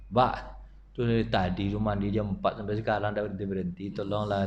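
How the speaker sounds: low, soft and fast, a worried plea; hushed hurried plea. Retold or imitated. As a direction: hurry the words, quietly and anxiously. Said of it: "Mbak saya itu datang". (permohonan lirih, pelan dan cepat)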